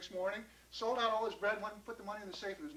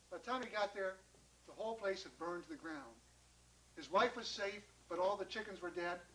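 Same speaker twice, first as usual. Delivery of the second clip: plain reading voice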